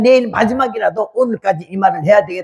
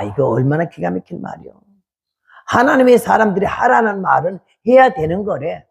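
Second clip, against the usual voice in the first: usual speaking voice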